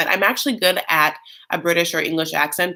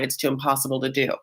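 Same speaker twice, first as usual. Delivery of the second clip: normal talking voice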